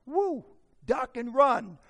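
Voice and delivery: loud, high comic warning; mock alarm. Husband bracing for his wife's outburst, mock alarm. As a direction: shout it like incoming fire.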